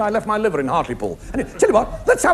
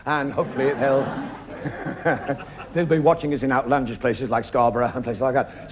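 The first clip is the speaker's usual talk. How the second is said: ordinary talk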